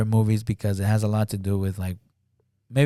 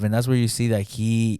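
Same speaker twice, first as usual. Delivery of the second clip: usual speaking voice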